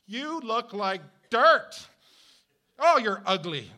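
hammed-up rude jab; mock insult. Imagined worker insulting coworkers, loud hammed-up jab.

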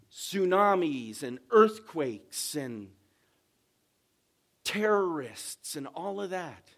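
loud, rising preacher's cry; preaching at full force. Acted. Pastor preaching hard to his congregation, at full force. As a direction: preach it out to the back pews.

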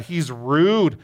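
ordinary delivery

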